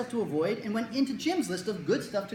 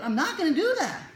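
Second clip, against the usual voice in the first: put-on higher, louder stubborn kid refusal; 10 semitones higher, 6 dB louder than usual. Kid refusing the doctor again, defiant and louder. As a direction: dig in like a defiant child.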